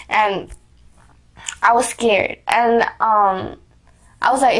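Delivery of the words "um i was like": ordinary talk, nothing marked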